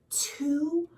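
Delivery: usual speaking voice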